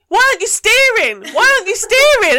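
high, loud exasperated shout; exasperated yell. Retold or imitated. Tractor driver shouting back at the woman being towed, exasperated. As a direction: yell it in disbelief at her. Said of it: "and goes".

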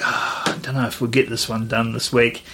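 low, quiet, weary doubt; mock reluctance. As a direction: sigh into a half-hearted excuse. Retells their own reluctance to record.

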